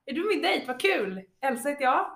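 high, loud, eager mock greeting; glad dejthälsning. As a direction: greet your date with acted delight. Acts out a keen date greeting. (överdrivet glad hälsning)

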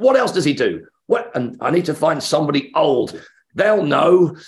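high, eager fan burst; excited questioning. Retold or imitated. New fan asks what else he does, excited. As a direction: act out keen, urgent curiosity.

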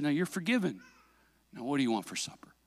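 hushed, low fatherly voice; gentle forgiveness. Retold or imitated. Dad forgiving his son and moving on to supper, gentle. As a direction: close the matter softly, as Dad.

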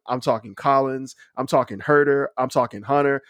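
normal talking voice

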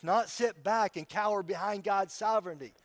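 ordinary delivery

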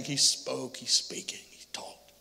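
usual speaking voice

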